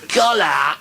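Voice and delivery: high parade-ground yell; sergeant's drawn-out order. Acted. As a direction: call it out like an army sergeant. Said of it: "the sergeant says".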